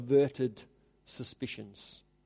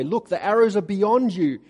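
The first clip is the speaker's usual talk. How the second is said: loud called signal, falling on arrows; 6 semitones higher, 8 dB louder than usual. Retold or imitated. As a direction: shout it out to the boy as a warning. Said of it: "if I say to the boy".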